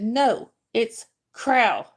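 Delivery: "Crow" said as "cral", one syllable for Corral; Southern pronunciation. Retold or imitated. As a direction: imitate a kid insisting on "Crow", meaning one-syllable "cral". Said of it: "you've learned the Southern version".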